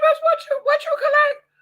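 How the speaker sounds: high, loud shout of disbelief; astonished shriek. Retold or imitated. Asking a woman what she collected, astonished shriek. Retells her astonished reaction.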